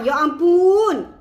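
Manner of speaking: loud, slow, drawn-out AMPUN of rapture; swooning exclamation. Shown as a demonstration. Coach models reaction for followers, swooning. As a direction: stretch it wide as if overcome by flavour. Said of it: "Dia macam, nampak tak?".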